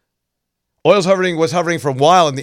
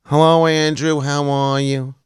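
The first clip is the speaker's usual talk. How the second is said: put-on New York accent. Host mimicking folks back in New York, broad New York accent. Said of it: "everybody I knew from back in New York".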